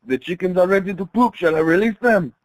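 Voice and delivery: mock French accent. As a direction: put on a hammed-up French accent. Acts French officer reporting to general.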